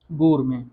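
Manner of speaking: गुरमे: ग जैसे गर्ल, ending नासिक मे; French word gourmet. Shown as a demonstration. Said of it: "इसलिए उसको pronounce करेंगे". (गुरमे को फ्रेंच ढंग से, ज़ोर से)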